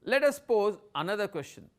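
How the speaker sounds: ordinary talk, nothing marked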